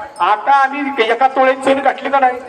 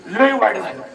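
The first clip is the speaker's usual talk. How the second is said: mock mourning; 7 semitones lower than usual. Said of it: "इतकी रडत होती ना तिथं ती".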